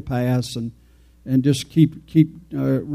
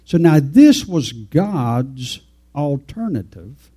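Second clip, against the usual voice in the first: normal talking voice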